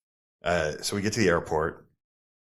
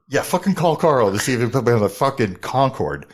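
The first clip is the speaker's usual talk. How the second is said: loud, higher sarcastic go-ahead; scoffing disbelief; 4 semitones higher, 6 dB louder than usual. Retold or imitated. Traveler scoffs at driver's offer to call, scoffing disbelief. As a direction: dare him loudly, sure it's hopeless. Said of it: "knowing, like, that's never gonna fucking happen".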